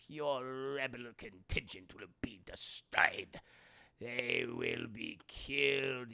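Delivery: low, slow sinister whisper; tempting devilish whisper. Acted. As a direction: whisper it like an evil emperor. Palpatine taunts Luke.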